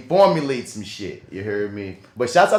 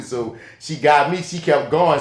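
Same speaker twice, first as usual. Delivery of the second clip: ordinary talk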